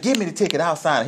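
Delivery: high-pitched mock insistence; imitating pleading woman. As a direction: give in hurriedly as the old lady. Retold woman giving in hurriedly.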